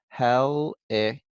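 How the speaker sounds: slow "Helicopter" in separated parts, last one drawn out; syllable by syllable. Shown as a demonstration. Teacher reads back the spelled word, syllable by syllable. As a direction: read "Helicopter" back piece by piece. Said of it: "spell out each syllable one part at a time".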